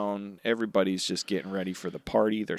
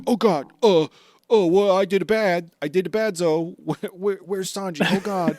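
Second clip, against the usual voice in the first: high, loud flustered panic; hammed-up freak-out over a mistake; panicking out loud after waking in the wrong bed; mock panic; 7 semitones higher, 6 dB louder than usual. Panicked Eggplant Man voiced.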